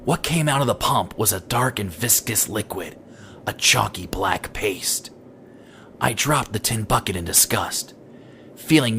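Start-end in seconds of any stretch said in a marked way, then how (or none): none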